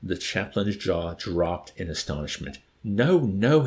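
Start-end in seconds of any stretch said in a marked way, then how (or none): 2.8-3.7 loud pained protest; a groan of dismay in the words. Acted. Chaplain begging Wickham to stop, groaning protest. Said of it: "he groaned".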